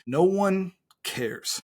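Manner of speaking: slowly, each word given its own space. Real, not acted. Coach stressing a key point to listening coaches, very slowly. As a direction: draw it out very slowly. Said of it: "Say this very slowly".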